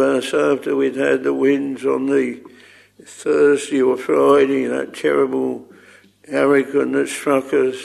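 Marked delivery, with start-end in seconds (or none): none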